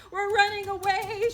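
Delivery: loud, high excited shout. A narrator's reading. Betsy horse bolting off, loud excited shout. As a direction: yell it like a runaway horse. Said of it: "shouted the Betsy horse".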